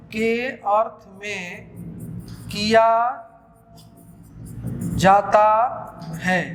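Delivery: slow, word-by-word dictation with long pauses. Shown as a demonstration. Teacher dictating a grammar rule to students, slow and deliberate. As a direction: spell it out slowly so everyone can write.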